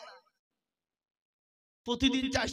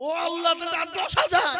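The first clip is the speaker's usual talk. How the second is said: pleading appeal; 6 semitones higher, 6 dB louder than usual. Real, not acted. Preacher begging the crowd for ten thousand more, pleading. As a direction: plead with a rising pitch.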